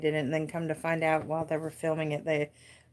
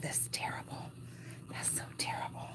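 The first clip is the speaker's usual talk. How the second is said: whisper; 13 semitones lower, 15 dB quieter than usual. Real, not acted. Embarrassed at own crochet display.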